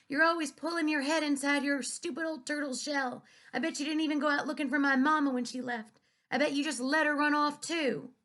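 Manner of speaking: high, loud, furious; angry accusation. A narrator's reading. Daughter blaming the preacher for mama leaving, high and loud. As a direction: accuse him with all your hurt.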